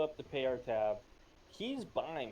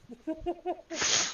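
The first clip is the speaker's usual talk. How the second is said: high burst of laughter; 9 dB louder than usual. Real, not acted.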